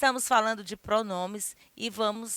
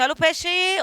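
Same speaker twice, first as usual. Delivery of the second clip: higher-pitched vendor's cry, lightly done; light imitation of a fishmonger's call; street fish seller; 8 semitones higher, 3 dB louder than usual. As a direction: call out the fish like a market hawker. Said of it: "Eles falam assim".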